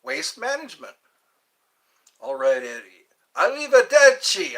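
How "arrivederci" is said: "Arrivederci" called out high and loud; loud Italian goodbye. Shown as a demonstration. Practising Italian goodbyes for Eddie, calling "Arrivederci" loud and high. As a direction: belt out "Arrivederci" with a big falling tune. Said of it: "I gotta learn these words".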